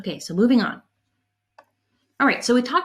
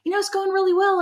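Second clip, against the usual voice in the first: eager reply; 10 semitones higher, faster than usual. Retold or imitated. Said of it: "And I said".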